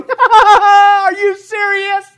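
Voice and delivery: slow, loud, incredulous mock challenge; drawn-out, booming disbelief; God on the throne. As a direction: laugh off their threat from the throne. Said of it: "on the throne going".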